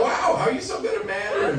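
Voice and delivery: high, quick, impressed question; amazed admirer. Acted. Admirers marvel at his Mandarin.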